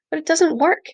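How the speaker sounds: high, exasperated protest. A narrator's reading. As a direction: sound fed up with him. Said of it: "Malky sounded exasperated".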